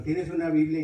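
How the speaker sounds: pregunta gritada, más aguda; grito a la hermana. Retold or imitated. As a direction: grita la pregunta hacia otro cuarto. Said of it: "le empecé a gritar a mi hermana". (petición urgente en voz alta)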